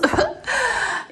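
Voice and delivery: high little giggle; short laugh. Real, not acted. Vlogger joking about paying the bills, high giggle. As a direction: laugh at your own joke.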